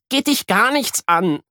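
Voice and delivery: put-on high sulky girl voice; high pouting girl. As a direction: brush him off. Sulking Miri answers.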